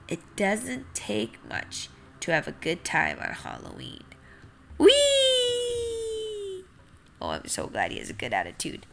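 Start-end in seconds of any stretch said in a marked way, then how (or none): 4.8-6.7 high drawn-out whee; gleeful whee. Acted. Happy seed jumping around, high long whee. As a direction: let it ring out in delight.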